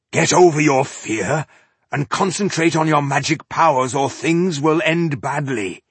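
loud, firm dwarf order; stern command. Acted. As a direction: shake her out of her fear. Dwarf pushes frightened Maga.

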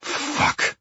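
low growl of frustration; growled curse. A narrator's reading. Man cursing as he makes a call, growled. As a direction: growl the swear through your teeth. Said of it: "I growl as I call Barney".

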